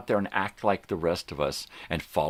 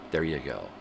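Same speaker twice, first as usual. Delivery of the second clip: normal talking voice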